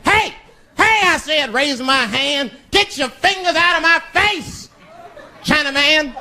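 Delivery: high, squeaky mock outrage; squeaky mock anger. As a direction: raise the hand and squeak it out indignant. Voicing himself angry.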